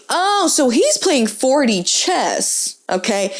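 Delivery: ordinary delivery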